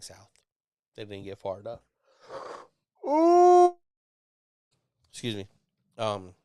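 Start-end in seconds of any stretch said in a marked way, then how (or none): 3.1-3.8 loud, high sustained ooh; involuntary high "ooh". Real, not acted. Excusing himself mid-story, loud involuntary ooh. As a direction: let out a sudden high-pitched noise.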